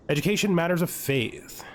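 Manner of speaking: ordinary talk, nothing marked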